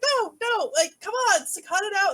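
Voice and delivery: put-on high, pleading kid voice, pushing him off; begging him to stop, mock whine; whiny little brother. Brother pulls his blanket off, pleading kid whine. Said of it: "and he's like".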